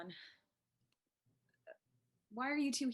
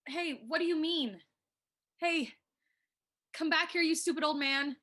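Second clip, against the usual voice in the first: angry calling out; 6 semitones higher than usual. Acted. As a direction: yell him back, furious. Calling after departing old man.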